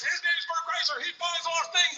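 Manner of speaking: high, strained call up to the sky; hammed-up proclamation. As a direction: proclaim it to the Lord, pitched far up. Made to proclaim it to the Lord.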